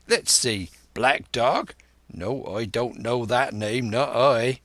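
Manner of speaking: ordinary delivery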